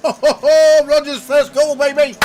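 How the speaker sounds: real joyful roar over a winning bet; goal celebration. Real, not acted. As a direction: yell it out like your bet just landed. Celebrating goal scorer bet win.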